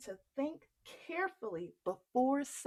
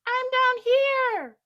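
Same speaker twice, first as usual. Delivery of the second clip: much higher, loud, slow call; 14 dB louder than usual. Acted. Jack calling up to the giantess, high and loud. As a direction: shout up to the giantess.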